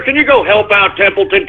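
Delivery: ordinary talk, nothing marked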